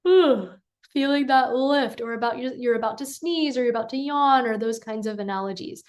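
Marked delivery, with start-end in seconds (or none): 0.1-0.5 higher ooh like smelling roses; lifted ooh. Shown as a demonstration. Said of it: "smelling the bouquet of roses".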